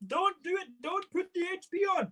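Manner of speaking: high squeaky mock protest voice; mock shouting critics. Viewers begging him not to add sauce, mock squeal. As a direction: squeal it like a horrified purist. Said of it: "some people are gonna be shouting".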